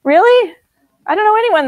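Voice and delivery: high, loud disbelieving "Really?"; sharp rising surprise; incredulous. Real, not acted. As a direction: scoff at the idea in disbelief. Incredulous reaction to peace claim.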